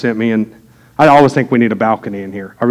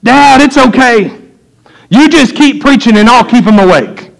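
put-on much higher, louder, slow kid's call down from the balcony; kid yelling down; 10 semitones higher, 5 dB louder than usual. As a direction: shout it up high like a cheeky boy calling to his dad. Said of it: "the oldest boy yells down".

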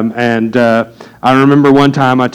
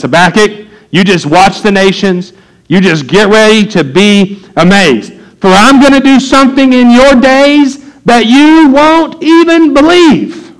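booming God voice; 8 semitones higher, 3 dB louder than usual. Acted. Preacher voices God's answer.

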